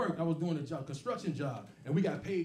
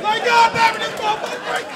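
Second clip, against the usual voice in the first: much higher, loud shocked outburst; stunned disbelief; 15 dB louder than usual. Retold or imitated. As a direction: gasp it out like you can't believe what you heard. Stunned reaction in the theater.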